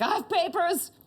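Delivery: indignant insistence. Retold or imitated. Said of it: "I'm like".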